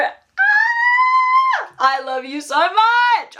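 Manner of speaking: high squeal of love; fangirl scream. Real, not acted. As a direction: shriek it like a fangirl. Fangirling over character.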